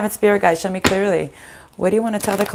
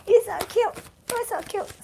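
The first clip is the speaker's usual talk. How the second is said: much higher cooing pet voice, fading away; 5 dB quieter than usual. Real, not acted. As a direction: coo at the puppy. Cooing at her dog.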